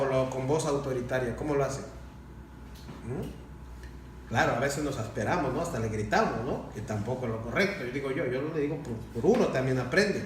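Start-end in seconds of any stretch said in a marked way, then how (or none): none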